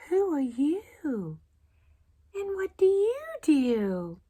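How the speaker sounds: high, slow kindly voice; zookeeper. Acted. Zookeeper greeting the lonely cow, high and slow. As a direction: ask her gently, drawn out.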